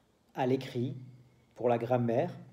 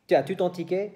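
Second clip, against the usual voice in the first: Quebec question; 5 semitones higher, 4 dB louder, faster than usual. Shown as a demonstration. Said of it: "En Québec".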